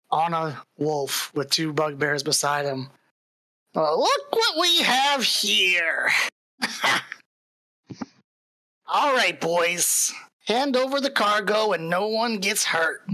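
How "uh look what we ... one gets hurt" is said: high, drawn-out goblin sneer; menacing goblin leader, pitched up; taunting goblin bandit. Acted. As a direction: gloat slowly over your cornered prey. Game master voices goblin ambusher.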